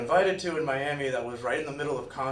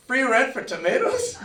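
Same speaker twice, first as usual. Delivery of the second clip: laughing disbelief; 10 semitones higher, 7 dB louder than usual. Retold or imitated. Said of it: "He's like".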